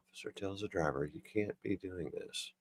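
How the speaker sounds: very soft, barely voiced reread; hushed, slowing as it goes; reading to oneself. Real, not acted. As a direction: puzzle over the line under your breath. Rereads the line to himself.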